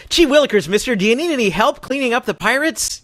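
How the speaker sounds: lightly voiced eager kid; wholesome 1950s kid. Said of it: "like a really eager kid and go".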